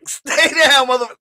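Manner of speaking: high loud bark; yelling at the screen. Real, not acted. Viewer yelling at the victim, high shout. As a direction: order her down, full force.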